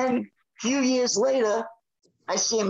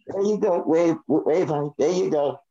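coaxing a pet; 6 semitones lower than usual. Real, not acted. Talking to his dog.

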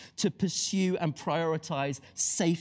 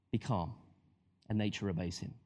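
much softer and lower; hushed calm; 8 semitones lower, 6 dB quieter than usual. Acted. Jesus to the storm, soft and low. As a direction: still the storm with a hush.

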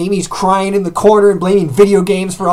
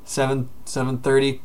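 usual speaking voice

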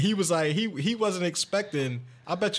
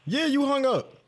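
high, incredulous; indignant; 11 semitones higher, 3 dB louder than usual. Real, not acted. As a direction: call him out. Accusing the caller, laughing.

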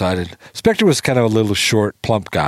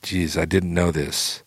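soft, slow sigh through the words; 4 semitones lower, 6 dB quieter than usual. Retold or imitated. Songwriter after watching the rehearsal, sighing softly and slowly. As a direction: let it out on a tired sigh. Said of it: "took his big sigh and he said".

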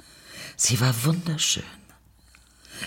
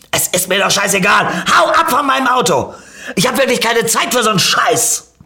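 high, loud, furious outburst; angry shouting; 11 semitones higher, 6 dB louder than usual. A narrator's reading. Car owner dismisses narrator's apology, angry shout. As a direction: rant at the top of your voice. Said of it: "schrie mich der Besitzer des Autos an".

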